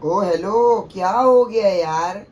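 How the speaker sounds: loud, slow, drawn-out call; exasperated call. Real, not acted. Husband hails his unresponsive wife, exasperated call. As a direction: call out for attention, stretching the words. Husband calling for wife's attention.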